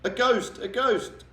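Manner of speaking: high screaming panic; panicked yelling. Acted. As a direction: people fleeing a ghost. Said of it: "with everybody yelling".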